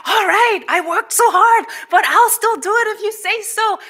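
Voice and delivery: high, bouncy, hammed-up willingness; mock cheerful eagerness. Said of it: "He wasn't like".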